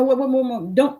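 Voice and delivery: ordinary delivery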